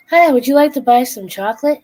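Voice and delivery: high, loud, bright door-to-door greeting; cheery salesman pitch. Retold or imitated. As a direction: sell chocolate with eager cheer. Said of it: "and says".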